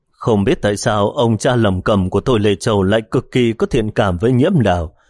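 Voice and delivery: plain reading voice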